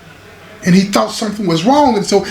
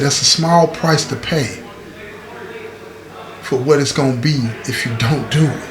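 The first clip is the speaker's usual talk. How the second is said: low grave counsel; 6 semitones lower, 5 dB quieter than usual. Retold or imitated. Chaplain's grave answer.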